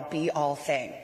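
normal talking voice

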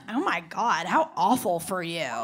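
low mimicked customer voice; mock condescending pity. Customer pities the bartender for her voice, mock condescension. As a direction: imitate a customer's insincere sympathy.

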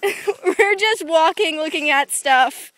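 laughing. Real, not acted. Laughing after being spotted.

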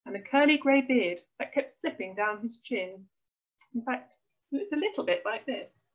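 ordinary delivery

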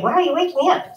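high, grumpy sleepy dog voice. Pretend. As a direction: voice the dog, woken and annoyed. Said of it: "she was like".

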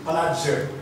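ordinary delivery

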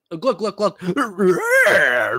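gurgle and growl like a hammed-up zombie; zombie impression. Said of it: "he does his best impression of a zombie".

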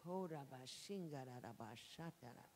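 murmured tongues of worship; speaking in tongues. Real, not acted. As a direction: pray in the Spirit. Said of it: "pray in the Holy Ghost".

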